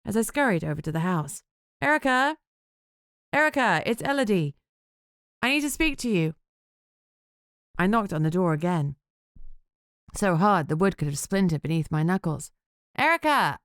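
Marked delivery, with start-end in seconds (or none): 1.8-6.4 raised voice, high, calling through a door. Acted. 13.0-13.6 high, raised call of a name. Acted. Knocking hard, calling again.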